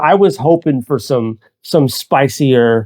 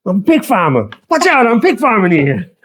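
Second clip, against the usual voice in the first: higher-pitched mock New York accent; John Leguizamo, New York accent; 7 semitones higher than usual. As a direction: call it out like a hammed-up New Yorker. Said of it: "sounded like he was from New York".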